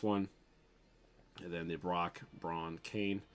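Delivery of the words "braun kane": ordinary talk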